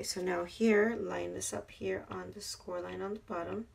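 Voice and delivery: ordinary talk, nothing marked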